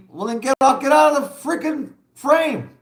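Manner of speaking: high, sharp mock retort; mock telling him to get out of the frame; retold retort. Retold telling man to leave.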